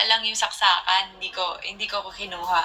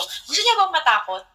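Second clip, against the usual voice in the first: higher, playful teasing ask; teasing question; 7 semitones higher than usual. Retold or imitated. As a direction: tease her about getting scared. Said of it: "Tapos sabi ko". (pabirong tanong)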